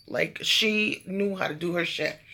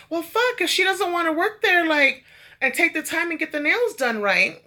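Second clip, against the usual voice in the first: indignant; 10 semitones higher than usual. Real, not acted. Complaining to viewers about the nail tech, high exasperated rant. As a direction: pitch shooting up in exasperation.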